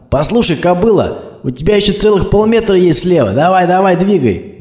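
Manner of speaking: swaggering tough guy barking from his jeep; cocky thug. Acted. Thug in a Hummer heckling a driver, brash and loud.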